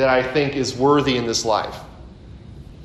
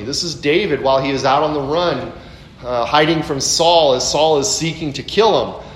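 usual speaking voice

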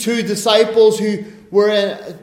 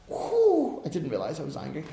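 sheepish reply; 6 semitones lower, 10 dB quieter, faster than usual. Acted. Acting out an argument.

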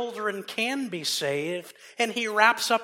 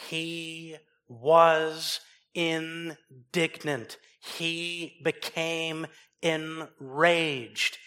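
very slow, weighty words; slow emphatic build; much slower than usual. Acted. Preacher to congregation, slow and heavy emphasis. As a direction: drag out each word to land the anger.

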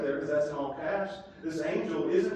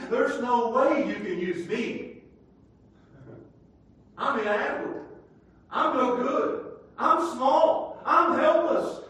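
lightly voiced self-pitying Gideon, rising louder; Gideon's excuses; 7 semitones higher, 4 dB louder, slower than usual. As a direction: build his pleading excuses higher. Voicing Gideon's self-pity.

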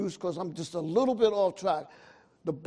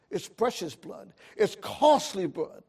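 ordinary talk, nothing marked

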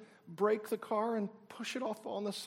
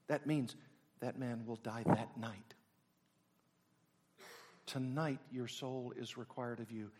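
lower, softer grave sermon voice; solemn hushed preaching; 7 semitones lower, 4 dB quieter than usual. Acted. Preacher quietly warns the congregation of death. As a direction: drop down and let it sink in.

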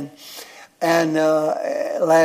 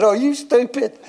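high-pitched imitation of a scolding wife; mock incredulous question, pitched up; 7 semitones higher than usual. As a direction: retell her disbelief in a higher voice. Wife's retort in a story.

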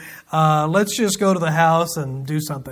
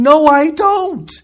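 high, slow, insistent denial; Job protesting; 8 semitones higher, 4 dB louder, slower than usual. Retold or imitated. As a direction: Job pushes back hard on his friends. Acted quarrel, Job denies.

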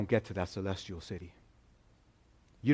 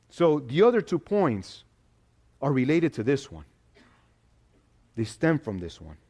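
louder, higher preaching build; fervent preaching; 4 semitones higher, 5 dB louder than usual. Acted. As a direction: preach it out with rising conviction. Preaching the central point.

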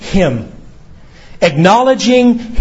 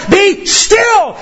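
much higher commanding shout; sovereign shout; 14 semitones higher than usual. Acted. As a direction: command the world to be still. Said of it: "This is a sovereign shout".

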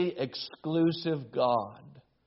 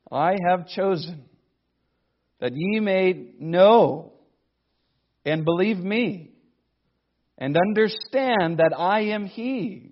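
louder, solemn reading of scripture; 4 semitones higher, 6 dB louder than usual. Acted. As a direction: proclaim the verse slowly and weightily. Preacher reads scripture aloud.

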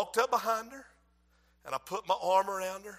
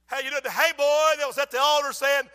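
loud, higher-pitched preacher delivery; raised-voice sermon delivery; preaching; 5 semitones higher, 8 dB louder than usual. Acted. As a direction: call it out forcefully from the pulpit. Preaching at full force.

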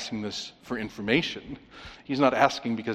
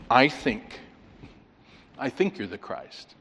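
tentative; 2 semitones lower than usual. Acted. Preacher voices what Peter didn't say, tentative. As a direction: lean doubtfully on "think" and "feel". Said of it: "he gives no wiggle room at all".

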